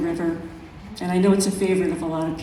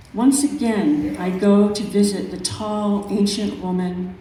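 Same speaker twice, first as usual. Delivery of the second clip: measured, unhurried poetry reading; calm, reflective recital of a nature poem. Acted. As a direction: let each image breathe in its pauses. Reads her poem aloud.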